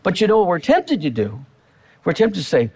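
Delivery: ordinary delivery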